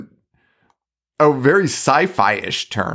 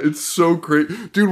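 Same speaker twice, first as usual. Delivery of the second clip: ordinary talk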